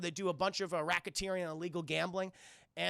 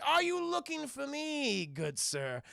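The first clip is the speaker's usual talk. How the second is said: higher, slow, oddly genteel greeting; mock polite stranger; 8 semitones higher, slower than usual. Disguised villain taunting the thug, slow and high. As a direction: sweetly lure him in.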